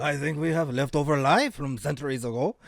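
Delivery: mock French onlooker; deadpan it in a French accent.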